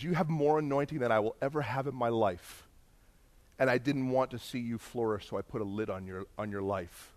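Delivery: imitated confession, soft and low; subdued, contrite murmur; tearful confession. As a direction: confess quietly, voice dropped. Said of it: "he starts to cry".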